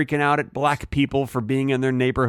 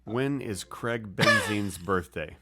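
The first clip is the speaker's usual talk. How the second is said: slow, measured, softer query; voice command; 5 semitones lower, 7 dB quieter, slower than usual. Real, not acted. Asking his phone for the birthday, slow voice command. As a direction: ask it slowly for a phone to understand.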